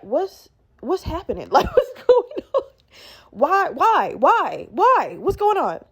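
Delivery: laughing imitation of a stunned manager, pitch leaping; bewildered questions climbing high; mock shock. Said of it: "she's, she's in shock".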